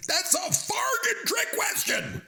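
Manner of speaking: high, drawn-out mock shout; movie quote outburst. Quizmaster quoting last episode's movie, high hammed-up shout. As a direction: holler it like an outraged film character. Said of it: "to quote our movie from last episode".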